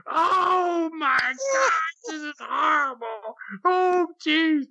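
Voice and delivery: high, cried-out mock shriek of horrified moms; horrified screaming. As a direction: wail it like a scandalized mother dragging her kids out. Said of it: "they started screaming".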